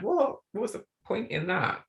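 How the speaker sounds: high-pitched mock bafflement; baffled reaction. Author voicing own reaction to too-short chapters, baffled.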